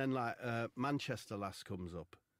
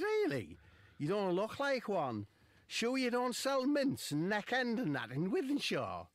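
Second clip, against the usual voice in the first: lass's voice; 11 semitones higher, 6 dB louder, slower than usual. Retold or imitated. Lass voiced in story.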